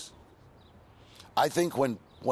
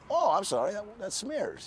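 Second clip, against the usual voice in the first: fake-innocent sorry, pitched up high; mock apology; 10 semitones higher than usual. Smearing actors' fake tattoos, pitched-up fake-innocent sorry. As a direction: play innocent while smudging on purpose.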